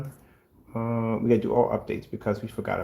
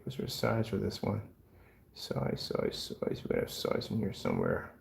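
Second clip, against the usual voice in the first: ordinary delivery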